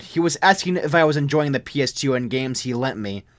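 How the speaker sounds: ordinary delivery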